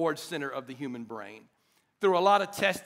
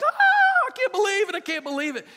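very high, loud squeal, easing down; hammed-up shriek of delighted disbelief; little-girl scream; 12 semitones higher, 6 dB louder than usual. Dad retelling his birthday surprise, squealing like a little girl. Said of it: "I screamed like a little girl".

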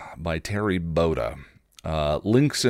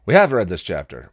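"have" hit louder, with a falling end; stress on "have"; 5 dB louder than usual. Real, not acted. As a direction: punch the word "have" as a firm realisation. Correcting herself on realising the repeat.

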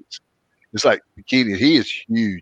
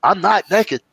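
much higher imitated voice of Seth; a pitched-up impression of the friend protesting; Seth's voice; 9 semitones higher, slower than usual. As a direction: mimic him pitched up high, insisting. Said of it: "He goes".

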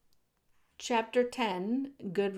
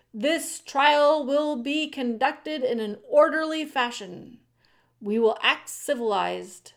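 louder, raised, formal ruling; commanding authority; 5 semitones higher, 6 dB louder than usual. A narrator's reading. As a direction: call the court to order. Head mouse calling trial to order.

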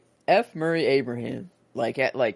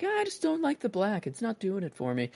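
quoted Gustave; 7 semitones higher, 7 dB quieter than usual. Retold or imitated. Quoting Gustave's complaint.

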